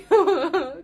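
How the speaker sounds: a choked laugh breaking into tears; teary laugh; laugh-cry. Real, not acted. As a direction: laugh through welling tears. Emotional about youngest turning three.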